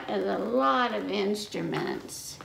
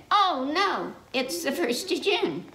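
worried cow; 6 semitones higher than usual. Acted. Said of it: "cow said".

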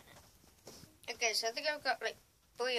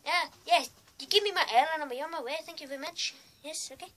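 high-pitched, badly faked Irish accent; unconvincing Irish impression, higher; attempted Irish accent; 6 semitones higher, 8 dB louder than usual.

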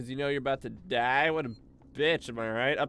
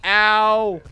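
loud higher panicked shouts; panicked yelling; 10 semitones higher, 16 dB louder, much slower than usual. Real, not acted. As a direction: yell as if burning. Character on fire in game.

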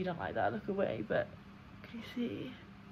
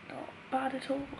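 ordinary talk

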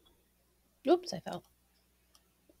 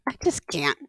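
unvoiced whisper quote; 4 semitones lower than usual. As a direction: breathe it out. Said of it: "I'm like".

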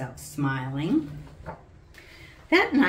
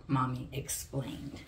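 ordinary delivery

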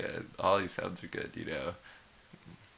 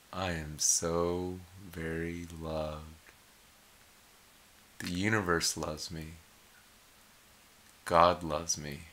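affirmation mantra; slower than usual. Shown as a demonstration. Guiding viewers through slow loving affirmations. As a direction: breathe each line slowly, meaning it. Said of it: "we're gonna do".

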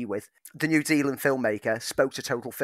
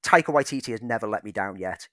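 normal talking voice

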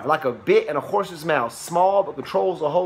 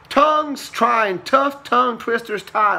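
slow, higher, each word spaced out; slow careful tongue twister; 6 semitones higher, slower than usual. Shown as a demonstration. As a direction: read TONGUES TRYING TOUGH TONGUE TWISTERS TIE slowly and deliberately. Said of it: "Say it three times real fast".

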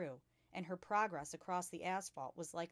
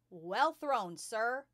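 loud, called-out cheer; shouted from afar; 4 semitones higher, 9 dB louder, much slower than usual. A narrator's reading. Onlooker applauds the throw from afar, shouting. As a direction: call it across the playground. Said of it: "someone shouted from across".

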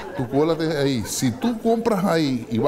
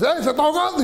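alarmed cry; 13 semitones higher than usual. Acted. Fishermen spot drowning man.

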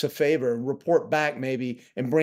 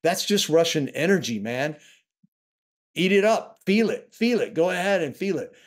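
bitter mock encouragement, rising higher; mock-cheerful sarcasm, pitched up on eat it up; sarcastic; 4 semitones higher than usual. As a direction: taunt him with his own words. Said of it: "you can explain to them".

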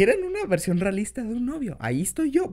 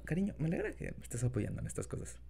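put-on soft boyfriend voice; gentle, intimate partner talk; incubus; 8 semitones lower, 5 dB quieter than usual. Talks to listener as boyfriend.

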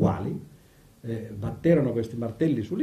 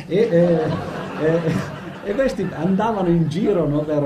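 laughing; 5 semitones higher, 6 dB louder, slower than usual. Real, not acted. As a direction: ride mentre parla, più acuto e più forte. Laughs at his own comet joke. (ridendo della propria battuta sui filosofi)

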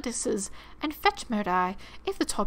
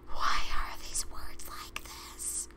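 slow, drawn-out whisper; softly stretched-out, bewildered whisper; baffled breathy aside; 5 dB quieter, much slower than usual. Real, not acted. Baffled aside at odd wording.

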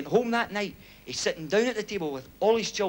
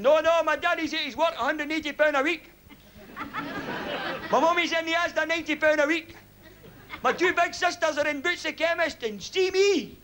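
put-on wee boy; 8 semitones higher than usual. Wee boy reciting dad's story to the man at the door, high child voice. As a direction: recite it like a child's rehearsed lines, high-pitched. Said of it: "The wee boy opened the door".

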